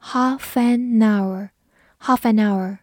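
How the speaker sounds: half an hour linked as one, half-a-nour, slowly; linked words. Shown as a demonstration. Teacher modelling linking in half an hour, slow. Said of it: "注意中间有两处连读".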